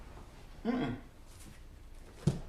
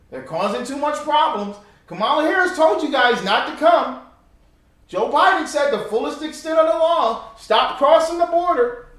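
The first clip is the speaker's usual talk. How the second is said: higher, louder mocking imitation; hammed-up impression of how they talk; 10 semitones higher, 6 dB louder than usual. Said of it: "that's just how they talk".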